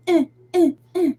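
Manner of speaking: high nasal mock whining; imitated toddler whine. Mom imitating her toddler's back-seat whining. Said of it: "like, whines".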